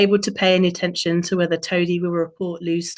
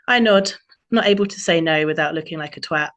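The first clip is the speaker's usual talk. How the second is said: ordinary delivery, nothing marked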